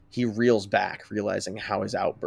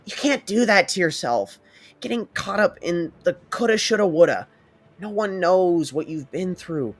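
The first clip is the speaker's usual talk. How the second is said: higher, urgent mother's voice comforting her son; a worried mom insisting he stop blaming himself; insistent mother; 8 semitones higher than usual. Acted. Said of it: "Inko insists".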